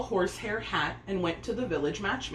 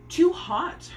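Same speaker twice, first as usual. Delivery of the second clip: high, loud scolding cry; 10 semitones higher, 6 dB louder than usual. A narrator's reading. Stepmother scolding Pear Blossom over the hot kitchen, high and loud. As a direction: snap it out like a fussy stepmother. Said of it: "her stepmother scolded then".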